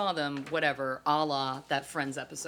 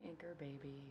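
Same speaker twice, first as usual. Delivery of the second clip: soft, sly whisper; whispered tease; 15 dB quieter than usual. Real, not acted. Teasing co-host about his family, whispered aside. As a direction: slip it in under your breath, whispered.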